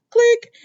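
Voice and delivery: sharp high "click" like a hang-up; mock phone click. Retelling Lee hanging up on Taylor, a mock click. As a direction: snap out a high, loud click sound.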